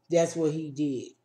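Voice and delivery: usual speaking voice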